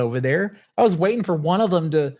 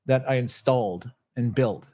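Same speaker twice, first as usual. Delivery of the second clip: ordinary talk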